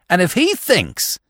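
high-pitched outraged opening; indignant. A narrator's reading. McTodd rising to refuse the castle visit, indignant. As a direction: launch indignantly into a protest. McTodd's tirade continues as he rises.

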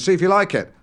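ordinary talk

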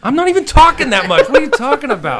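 high, loud, laughing denial; indignant protest. Real, not acted. Protesting being told shut up.

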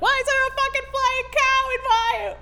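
high shocked yelp at a flying cow; shocked Mary. Acted. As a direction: freak out, voice way up.

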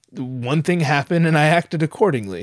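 usual speaking voice

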